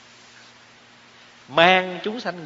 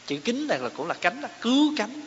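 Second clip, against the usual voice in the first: ordinary talk, nothing marked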